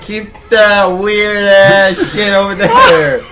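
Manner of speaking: mock female voice. Mock protest in female role.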